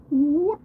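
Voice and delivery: very high, loud rising "woot" with a dripping end; one sharp rising hoot that drips off at the end; young Sasquatch call. Shown as a demonstration. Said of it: "a dripping sound at the end".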